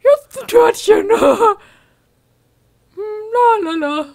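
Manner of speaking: high silly Sim voice. Pretend. Voicing the Sim, humming.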